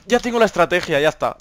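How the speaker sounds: ordinary talk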